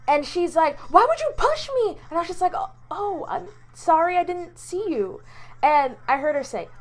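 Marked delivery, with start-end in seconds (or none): 0.9-2.0 high-pitched indignant mock yell; retold yell. Lady yells at her after a bump off the bus, indignant. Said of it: "she yells at me". 2.6-5.2 high-pitched, hesitant retold apology; startled apology. Apologizing to the lady she bumped into, startled.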